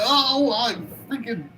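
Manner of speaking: high mock panicked shriek; mock scream. Recapping the anime, imitating the mannequin's high panicked shriek. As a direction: squeal it like the scared mannequin. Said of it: "all running and screaming like".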